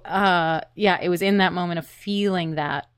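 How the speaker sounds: usual speaking voice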